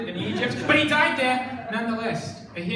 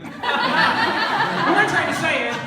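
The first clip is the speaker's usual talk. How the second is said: slow, higher, laughing catchphrase; laughing through it; 6 semitones higher, 5 dB louder, much slower than usual. Shown as a demonstration. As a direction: push through the giggles. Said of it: "repeated catchphrase".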